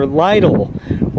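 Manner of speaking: "Lidl" said as LIE-dul; "Lidl" rhyming with "idle"; long-i store name. Shown as a demonstration. Offers store name in variants.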